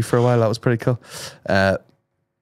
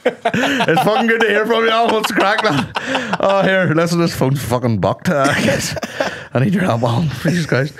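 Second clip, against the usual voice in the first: mock overfamiliar; 9 semitones higher than usual. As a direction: greet a stranger like a long-lost pal. Overfamiliar reply to operator.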